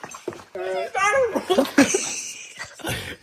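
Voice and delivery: high giggling laugh. Real, not acted. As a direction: crack up at own joke. Laughs after [name] line.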